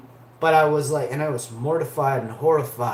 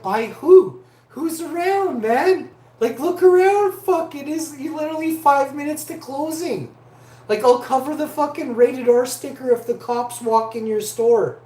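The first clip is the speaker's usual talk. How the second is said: exasperated pleading; 9 semitones higher, 5 dB louder than usual. Retold or imitated. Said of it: "I'm like".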